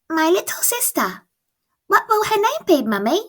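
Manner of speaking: put-on high, eager small girl asking her mum; little girl voice. Francie asks Mum about her sister, high child voice. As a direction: lift into a bright child's voice.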